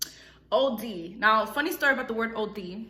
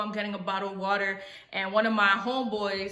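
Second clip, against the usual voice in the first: normal talking voice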